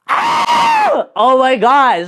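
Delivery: very high, loud shriek of disbelief; excited scream. Retold or imitated. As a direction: re-enact screaming at huge good news. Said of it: "I remember just screaming".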